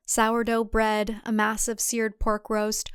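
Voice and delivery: plain reading voice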